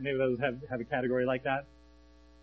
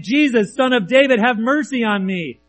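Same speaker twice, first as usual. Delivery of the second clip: loud, high plea for mercy; high, ringing call for help; 7 semitones higher, 11 dB louder than usual. Acted. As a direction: cry out above a crowd, drawing out the end. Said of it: "he called out".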